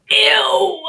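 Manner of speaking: disgusted ew. Real, not acted. Disgust at profile.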